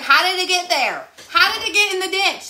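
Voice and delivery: high, loud wonder; amazed. Retold or imitated. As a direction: marvel at it. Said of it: "I was a little mesmerized".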